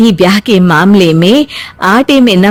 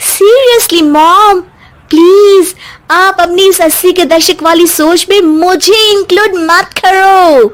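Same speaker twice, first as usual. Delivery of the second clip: high, sassy talking back to mother-in-law; spoiled daughter-in-law snapping back at her mother-in-law, high and dismissive; bratty modern bahu; 10 semitones higher than usual. Acted. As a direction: toss off the old-fashioned elders, much higher than own voice. Daughter-in-law mocks mother-in-law's thinking.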